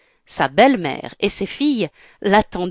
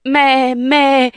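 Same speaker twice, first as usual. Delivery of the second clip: loud, raised, stammered objection; urgent protest; 5 semitones higher, 5 dB louder than usual. Acted. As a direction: protest as she gets away. Prince protests as she flees. (protestation pressante, voix forte)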